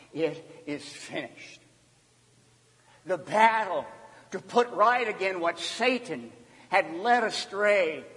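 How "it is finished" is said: soft, low and spaced out; solemn last cry. Acted. Jesus from his blood-soaked cross, hushed and solemn. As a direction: say it quietly, word by word. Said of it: "when Jesus cried out".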